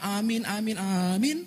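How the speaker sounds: high, rising amens; hammed-up churchgoer's amen, pitched high and drawn upward; mock-pious amens. Hypocrite couple at the end of worship, mock-pious high amens. Acting fake piety at service's end.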